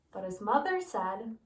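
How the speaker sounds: plain reading voice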